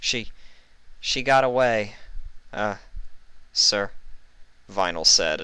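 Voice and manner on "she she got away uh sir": a tad afraid. A narrator's reading. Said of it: "a tad bit afraid".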